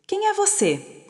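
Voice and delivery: a lightly voiced small girl, high and curious; little girl's voice. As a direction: ask it as a shy child up high. Little girl speaks to Isadora.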